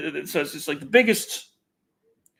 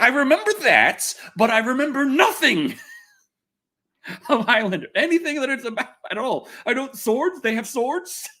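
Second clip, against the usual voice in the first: laughing; 8 semitones higher than usual. Real, not acted. Amused at forgetting the show, laughing through it. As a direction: laugh through the words at yourself.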